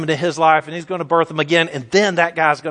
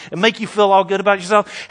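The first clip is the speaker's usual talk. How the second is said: usual speaking voice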